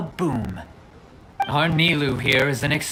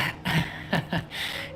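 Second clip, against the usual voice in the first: awkward laugh; 7 dB quieter than usual. Acted. Caught out, awkward.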